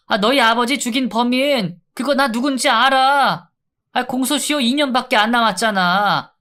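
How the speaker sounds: pleading inmate. Acted. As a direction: beg to be let out, whimpering.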